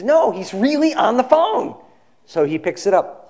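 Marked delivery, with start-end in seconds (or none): 0.0-1.8 high-pitched insistence, rising at the end; insistent. Retold or imitated. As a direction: press the point with rising pitch. Secretary insists to disbelieving boss.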